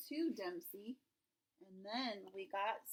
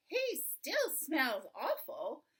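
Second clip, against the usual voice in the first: slow, louder, higher complaint with big swoops; mother's exclamation; 4 semitones higher, 6 dB louder, slower than usual. A narrator's reading. Mother walking in on the bathed dog, loud dismay. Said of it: "Mother walks in and says".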